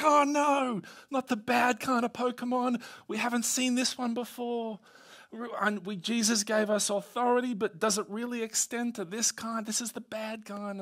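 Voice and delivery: high-pitched playful panic, easing off in volume; mock dismay. Disciples seeing the foaming boy, hammed-up dismay. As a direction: wail the first words, then fret on high.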